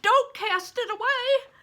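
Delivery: loud, high cry. A narrator's reading. Crispin crying out as Bear nears the fire, loud. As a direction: cry out in alarm. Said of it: "I cried".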